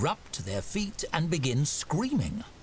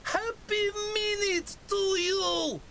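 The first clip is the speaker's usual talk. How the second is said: very high, slow celebratory orc cry; orc's hearty cheer; slower than usual. A narrator's reading. Orc toasts the tavern keeper, hearty cheer.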